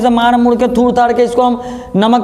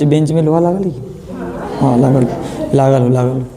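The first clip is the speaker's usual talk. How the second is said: normal talking voice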